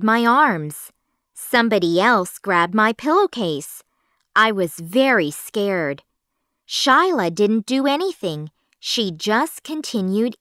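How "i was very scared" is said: usual speaking voice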